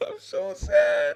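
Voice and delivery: high fake wail with laughter breaking through; hammed-up crybaby sob; mock crying. Streamer fake-cries to chat about being bullied, high mock wail. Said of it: "Sorry, I'm still crying".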